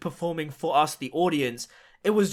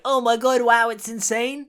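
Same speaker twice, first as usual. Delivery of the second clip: mock gushing fans; 5 semitones higher, 4 dB louder than usual. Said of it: "just going like".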